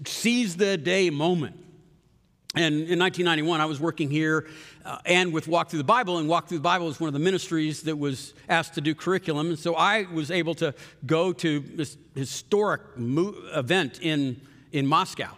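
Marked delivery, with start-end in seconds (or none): none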